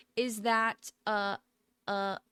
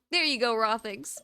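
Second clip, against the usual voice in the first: ordinary talk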